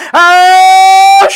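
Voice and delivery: a sudden cry-shout rising high and loud. Real, not acted. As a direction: let out a wailing preacher's cry. Preacher building to a cry.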